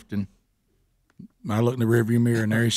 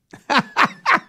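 high laugh; 13 semitones higher, 3 dB louder than usual. Real, not acted. Teller laughs at his story.

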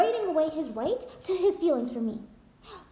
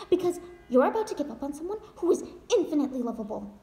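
usual speaking voice

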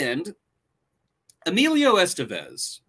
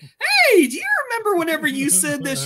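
teasing; 13 semitones higher, 5 dB louder than usual. Retold or imitated. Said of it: "we still hit".

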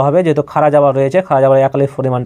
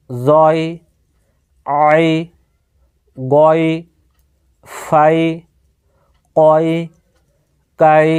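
each syllable chanted alone, with pauses; Quran recitation drill; much slower than usual. Shown as a demonstration. Teacher drilling Arabic sounds for students, measured chant.

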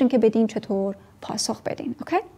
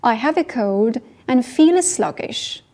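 slow model sentence; much slower than usual. Shown as a demonstration. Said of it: "model sentence".

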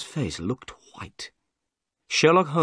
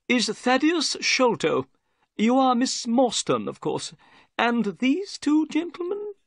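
very high, nervous little man's voice; Thaddeus Sholto; 12 semitones higher than usual. A narrator's reading. Bald little man greets his guests, nervous. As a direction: pitch it high as a strange, nervous host. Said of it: "he seemed very nervous".

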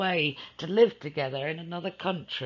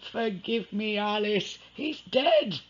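higher, strained confession; blurted out; 8 semitones higher than usual. Acted. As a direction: blurt the bad news out. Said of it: "Edward blurted out".

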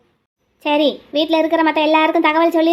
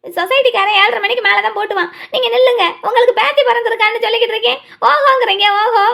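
tearful protest; 6 semitones higher than usual. Acted. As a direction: tell your husband off through tears. Wife protesting at husband's coldness.

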